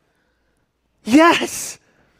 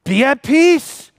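slow, mocking calm voice; imitating an easy command of peace; mock serene command; 4 semitones higher, 3 dB louder, slower than usual. A worshipper mocking Jesus's calm command to be at peace. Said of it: "really easy for you to say".

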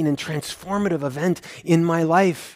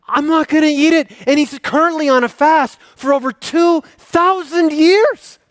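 loud, high, ringing sermon voice; full-force preaching; 9 semitones higher, 7 dB louder than usual. Acted. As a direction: preach it out to the whole church.

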